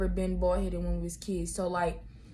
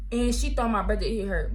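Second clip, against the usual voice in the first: usual speaking voice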